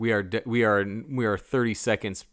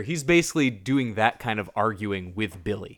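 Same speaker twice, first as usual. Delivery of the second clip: normal talking voice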